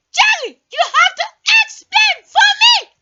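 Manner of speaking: fast, loud, high shouting with a laugh. Acted. Caller defending his shouting, loud and fast. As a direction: yell it proudly as if normal.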